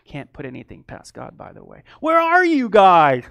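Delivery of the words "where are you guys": drawn-out high holler; loud calling out. Acted. God seeking hidden Adam and Eve, hollering playfully. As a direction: call louder into the distance, stretching it. Same call, repeated louder.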